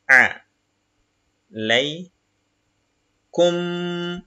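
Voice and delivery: each Arabic syllable said apart, slowly; syllable reading. Shown as a demonstration. Teacher reading Arabic syllables to the class, slow and separated. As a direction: spell the word out syllable by syllable. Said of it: "Donc, on va dire".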